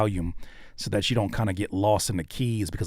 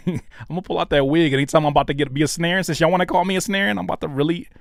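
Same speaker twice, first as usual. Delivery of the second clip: louder, higher, playful ranting; mock-indignant threat; 7 semitones higher, 4 dB louder, faster than usual. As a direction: wind up into a comic diva threat. Threatens to bring out wig persona.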